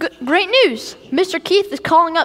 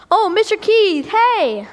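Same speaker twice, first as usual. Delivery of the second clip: normal talking voice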